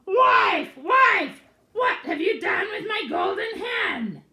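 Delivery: high, loud furious giant shout; roaring ogre. Acted. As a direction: bellow for the wife in a rage. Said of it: "he heard him roaring".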